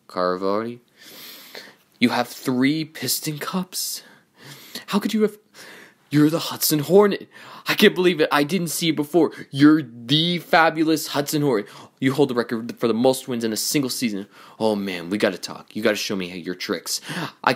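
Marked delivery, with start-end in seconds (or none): 4.9-11.7 high, breathless-fan gushing; starstruck excitement. Acted. Racer discovering Doc is the Hudson Hornet, thrilled. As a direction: gush in amazed disbelief.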